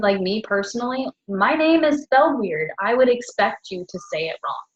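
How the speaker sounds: ordinary talk, nothing marked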